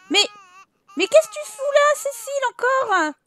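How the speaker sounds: high, loud indignant outburst; indignant, stretched-out telling-off; exasperated scolding. Real, not acted. Scolding intruding Sim Cécile.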